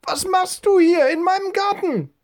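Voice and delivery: put-on very high falsetto old lady, calling out; indignant woman's voice. A narrator's reading. Old woman catches boy in her garden, indignant high falsetto call. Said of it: "rief eine Frauenstimme hinter ihm".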